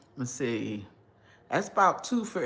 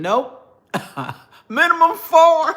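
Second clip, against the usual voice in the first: loud laughing joke, higher; 11 semitones higher, 9 dB louder, slower than usual. Real, not acted. Joking about portion size.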